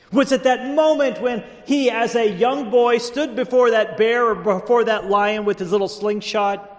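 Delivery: high, impassioned rhetorical questions; fired-up preacher in full flow; full-force preaching. Real, not acted. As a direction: build each question from the pulpit.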